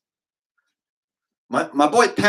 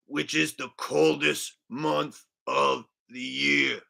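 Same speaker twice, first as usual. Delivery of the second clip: slow, lightly voiced vlogger drawl; a mild imitation of a vlogger rambling about the weather; Richie from Boston; slower than usual. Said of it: "updated Richie from Boston impression".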